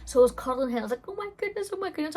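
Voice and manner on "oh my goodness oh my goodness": high, stunned oh my goodness; shocked. Retold or imitated. Holding her newborn, a shocked high exclamation. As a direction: gasp it out in disbelief, much higher. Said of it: "actually in shock".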